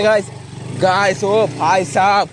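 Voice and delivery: hammed-up alarm while the bike slips; overacting panic. Real, not acted. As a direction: friend teases him for overacting as he yells in panic. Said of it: "over acting के वजह से".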